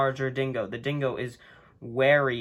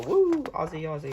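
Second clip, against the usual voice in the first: cheer; 2 semitones higher than usual. Real, not acted. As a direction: whoop like a fan.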